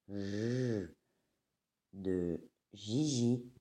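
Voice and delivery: ordinary delivery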